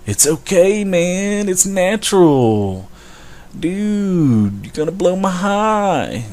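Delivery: dreamy, dragged-out stoner brushing off the warning; stoner impression. Mocking a stoner who says weed is harmless, in a slow, high, dreamy drawl.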